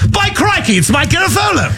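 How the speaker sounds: high, hammed-up Australian accent. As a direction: play the Aussie larrikin. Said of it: "in Australian accents".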